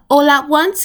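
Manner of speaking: ordinary delivery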